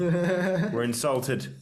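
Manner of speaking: slow, drawn-out mock indignation; mock offended. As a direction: stretch it out, fake hurt.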